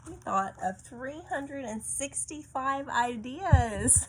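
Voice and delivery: ordinary delivery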